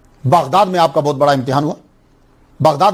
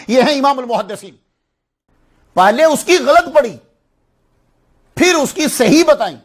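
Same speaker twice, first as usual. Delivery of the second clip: raised pitch, ringing praise; impassioned preaching; 7 semitones higher than usual. Real, not acted. Preacher extols Imam Bukhari's feat to the congregation, fervent proclamation. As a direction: proclaim each phrase with rising fervour.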